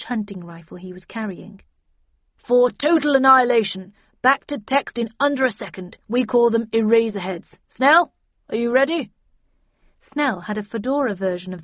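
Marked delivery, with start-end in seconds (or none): 2.3-9.1 loud, raised agent's voice; Bradshaw in character. Acted. Bradshaw briefing the team on ammunition, loud and emphatic. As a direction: declare it loudly, ending in a brisk challenge.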